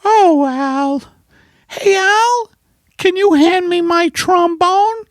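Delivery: high-pitched put-on wife voice; wife character. Wife corrects her husband about the vaccine, high character voice. Playing wife Margaret in sketch.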